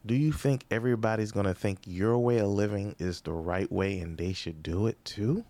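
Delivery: ordinary talk, nothing marked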